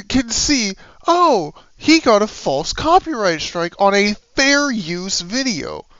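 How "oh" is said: usual speaking voice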